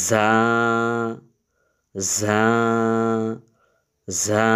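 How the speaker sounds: জা said with a buzz like a cricket. Shown as a demonstration. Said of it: "ঝিঝি পোকার মতন আওয়াজ হবে".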